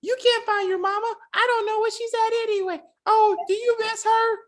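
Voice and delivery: put-on high, babyish little-girl voice; mock the actress's sugary child voice; fake kid voice. Mocking an actress's babyish kid voice to a cohost. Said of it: "the fake ass kid voice".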